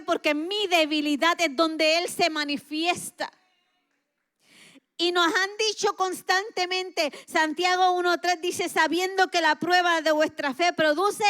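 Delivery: loud, high sermon climax; booming pulpit delivery; fiery preaching. Acted. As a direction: preach it out full force. Preaching at full force.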